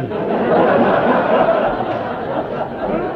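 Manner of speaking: high unvoiced burst of real laughter; a breathless, delighted laugh. Real, not acted. Laughs at own story.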